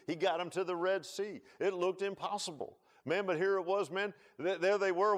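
high, loud preacher at full force; fiery preaching. Acted. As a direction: preach it out with rising fire.

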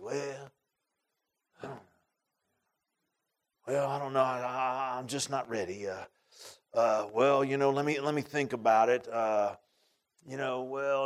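soft, halting hedging; mock reluctance. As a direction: imitate someone stalling with long pauses. Imitates a reluctant would-be convert's reply.

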